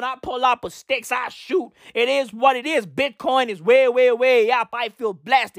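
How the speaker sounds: mock threatening tough-guy rapper; hammed-up warning to leave your partner alone. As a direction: imitate a rapper making rambling threats. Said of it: "making threats to [name]".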